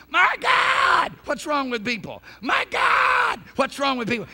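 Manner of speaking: high, loud cry on My God, falling off after; imitating Jack Cole. Preacher replaying Jack Cole's recorded cry, high shouted. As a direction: yelp My God way up, then drop. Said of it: "you just hear him going".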